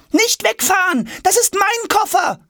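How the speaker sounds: put-on high-pitched desperate boy's yell; shouting. A narrator's reading. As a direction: yell after a departing wagon, very high. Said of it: "schreit Jonas". (verzweifelt hinterherschreien)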